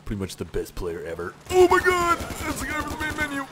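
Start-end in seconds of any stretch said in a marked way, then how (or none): 1.5-3.4 very high put-on fanboy squeal; mock excited squeal. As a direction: squeal in over-the-top excitement. Squeals at recognized game character.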